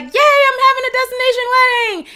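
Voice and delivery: high, loud squeal of joy; mock excited bride. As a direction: gush like a giddy bride. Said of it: "So you're super like".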